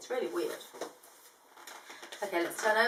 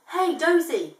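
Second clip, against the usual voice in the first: loud, high call up a tree; 6 semitones higher, 7 dB louder, slower than usual. Acted. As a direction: call up to the koala. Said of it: "Crikey called up".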